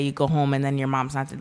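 ordinary talk, nothing marked

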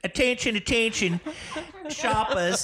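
mock Kiwi store announcement; New Zealand accent. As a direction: call out to shoppers in a put-on New Zealand accent. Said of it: "That's more of a Kiwi accent".

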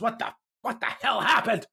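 high-pitched, exasperated boss act; mock dressing-down. Sheridan dresses down Garibaldi, mock exasperated scolding. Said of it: "Sheridan is dressing him down".

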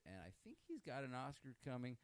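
usual speaking voice

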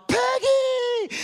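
imitated crowd shout, very high and loud; excited greeting. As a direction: holler it like cheering kids. Youth group greets her, all celebrating.

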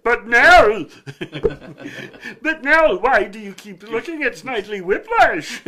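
high, loud, laughing mock swoon; mock swooning lady. The host imitates a lady swooning over Snidely Whiplash. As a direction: gush like a smitten girl.

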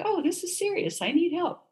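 high-pitched mock plea for help; imagined urgent plea. Patient imagining herself turning up at the ER, pleading in a high voice.

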